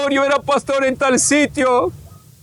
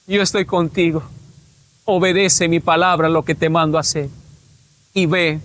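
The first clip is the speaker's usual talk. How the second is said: low, solemn voice of God; the Lord commanding; 6 semitones lower than usual. Retold or imitated. The Lord sends him back to earth, low commanding voice. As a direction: drop the pitch and instruct as God.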